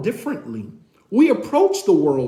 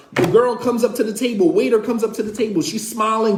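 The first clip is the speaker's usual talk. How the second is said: usual speaking voice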